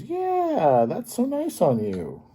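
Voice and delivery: yeah jumps way up, then fond praise; doting grandparent. Acted. Grandparent praising Red's scarf, high doting yeah. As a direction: gush over the scarf like a proud grandma.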